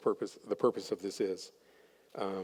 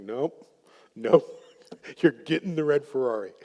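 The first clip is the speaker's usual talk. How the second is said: louder, laughing firm "nope"; imitating the husband, laughing; 2 semitones higher, 6 dB louder than usual. Husband insisting to wife on the Ferrari, laughing and louder. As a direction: playfully refuse with a laugh. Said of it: "And he's like".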